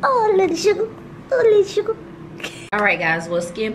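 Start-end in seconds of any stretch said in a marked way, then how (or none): 0.0-0.9 cooing. Real, not acted. Fans sent a cute product, she coos baby-talk at it. 1.3-2.0 cooing. Real, not acted. Cooing again.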